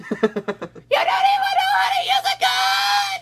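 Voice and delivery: put-on piercing, slow child tantrum voice; screaming five-year-old. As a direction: yell very high like a furious little kid. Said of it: "my impression of a five-year-old screaming at me".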